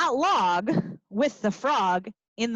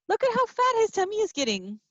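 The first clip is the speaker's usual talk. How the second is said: much higher, fast amazed aside; 10 semitones higher, faster than usual. Acted. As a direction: marvel at it for the kids.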